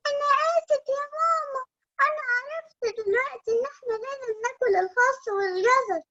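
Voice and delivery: put-on high, small apologetic child voice; sorry little bunny.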